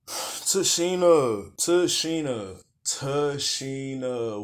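very slow, stretched-out name calling; drawn-out dismay. Real, not acted. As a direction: moan her name in disbelief.

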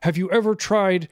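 high, slow, halting mock voice; high dopey voice. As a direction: drawl like a spacey church announcer. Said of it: "he's like".

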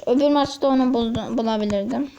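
ordinary delivery

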